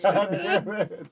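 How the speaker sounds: slow, laughing swear; laughing curse. Real, not acted. Bad roll, laughing curse.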